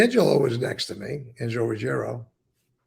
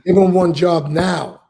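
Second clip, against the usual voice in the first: loud, slow, demanding order; 2 semitones higher, 10 dB louder than usual. Retold or imitated. Retelling Gotti demanding a job for him, loud and slow. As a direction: lay the order down hard and slow. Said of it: "Demanding now".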